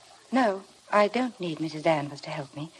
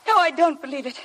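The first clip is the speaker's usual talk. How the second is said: higher, tense and shaken; frightened denial; 9 semitones higher than usual. Acted. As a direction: deny it, scared underneath. Heroine frightened by talk of ghosts.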